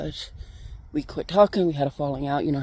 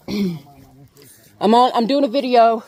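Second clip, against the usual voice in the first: louder, higher, calling to someone in another room; 7 semitones higher, 9 dB louder, much slower than usual. Real, not acted. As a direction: raise the voice so they hear from afar.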